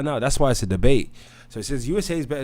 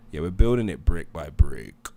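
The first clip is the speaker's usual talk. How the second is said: usual speaking voice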